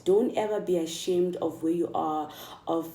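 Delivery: usual speaking voice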